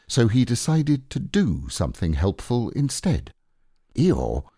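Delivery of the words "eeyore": low, solemn address. A narrator's reading. Bear begins a promise to his friend, low and solemn. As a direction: lower the pitch and address him solemnly. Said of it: "he said solemnly".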